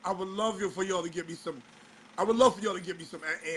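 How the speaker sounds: ordinary talk, nothing marked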